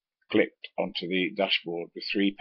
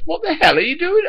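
high, loud furious demand; boss shouting; 12 dB louder than usual. Retold or imitated. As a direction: bark it at the driver like an angry boss.

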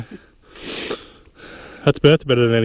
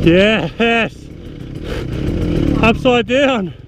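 high-pitched, elated exclamation; excited cheer; 4 semitones higher, much slower than usual. Real, not acted. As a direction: cry out at a wild bike flip. Celebrates bike flipping upside down.

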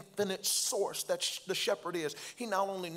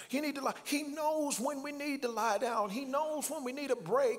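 high preaching climb, higher throughout; preaching whoop; 7 semitones higher than usual. Acted. Preacher telling weary preachers God knows their need, whooping. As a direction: lift every he knows into a sung whoop.

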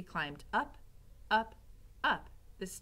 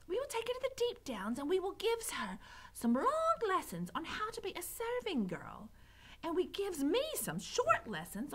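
put-on high-pitched, coarse-spoken serving girl; gleeful serving girl Mig; 12 semitones higher than usual. A narrator's reading. Mig's favourite part of plan.

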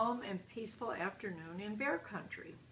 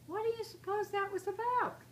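much higher, puzzled question; bewildered; 11 semitones higher, 3 dB louder than usual. A narrator's reading. Papa after the cubs dash off, bewildered. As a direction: ask it high-pitched, utterly at a loss. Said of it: "said a bewildered Papa".

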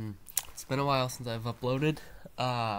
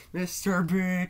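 "Mister B." called out higher and louder; meme shout; 6 semitones higher, 7 dB louder, slower than usual. Shown as a demonstration. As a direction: belt "Mister B." like the meme hype voice. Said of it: "That's how you do it".

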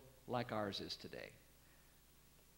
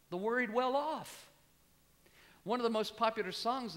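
fiery preacher's climax; full-force preaching; 9 semitones higher, 7 dB louder than usual. Acted. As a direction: ring out "rejoice" from the pulpit.

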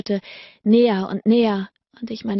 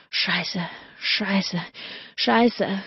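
panted; 3 dB quieter, much slower than usual. A narrator's reading. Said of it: "keuchte er in mein Haar".

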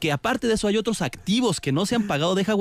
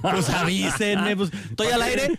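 quoted catchphrase; 3 semitones higher than usual. Retold or imitated. Host quoting someone caught on air, lightly mocking imitation. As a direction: stretch it out, imitating someone else's plea. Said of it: "diría el de, el del, pues avísenme".

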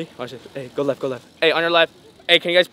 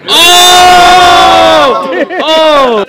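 high, loud drawn-out whoop; big whooping reaction; uproarious "oh!"; 8 semitones higher, 13 dB louder than usual. Real, not acted. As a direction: howl "oh!" at an outrageous punchline. Reaction to a crude joke.

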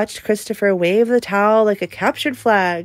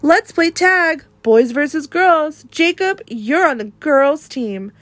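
taunting playground call, louder and higher; yelling bully; 6 semitones higher, 4 dB louder than usual. A narrator's reading. Christopher taunting Jacob on the playground, yelling. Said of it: "Christopher yelled".

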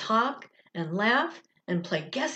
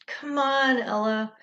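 impatient; 5 semitones higher than usual. A narrator's reading. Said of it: "said Maya impatiently".

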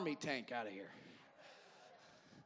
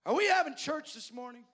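put-on much higher and louder indignant old church member; offended old man; 10 semitones higher, 9 dB louder than usual. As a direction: squawk it up high like an affronted old man. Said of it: "he looked up at me like he was offended".

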